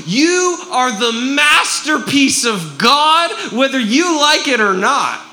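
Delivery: slow, loud, high proclamation; fervent proclaiming. Real, not acted. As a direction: declare it over them with conviction. Preacher proclaiming to congregation.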